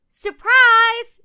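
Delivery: very high, very loud gleeful shout; yelled surprise. A narrator's reading. Hiding girl jumping out to surprise friends, loud shout. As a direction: burst out to startle your friends. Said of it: "yelled Janny".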